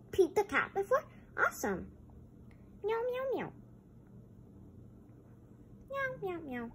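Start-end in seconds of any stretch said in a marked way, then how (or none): 2.8-6.7 high meows fading softer; cat meows. Pretend. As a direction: meow as the cat wanders off.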